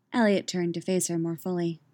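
ordinary delivery

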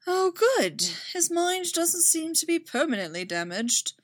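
a dry, teasing hint of relief; snarky mentor at the bedside; wry. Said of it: "a wry voice said".

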